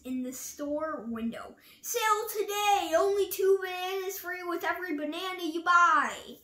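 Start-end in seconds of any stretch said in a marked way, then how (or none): 1.8-6.4 announcing sale. A narrator's reading. Reading sale sign aloud.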